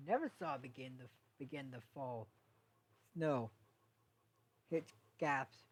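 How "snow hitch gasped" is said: plain reading voice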